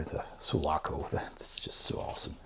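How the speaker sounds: hushed, breathy whisper of delight; awed whisper. Real, not acted. Fan whispering in awe over the Sulaco picture. Admiring the ship in the book.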